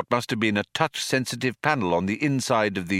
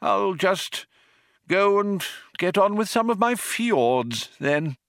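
put-on higher-pitched, hesitant old man; old man, taken aback; 11 semitones higher than usual. A narrator's reading. Old man excusing himself to his fjords, hesitant. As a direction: make a halting, awkward exit.

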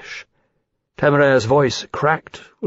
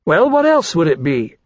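scornful retort; 5 semitones higher, 3 dB louder than usual. A narrator's reading. Caesar retorts to the question.

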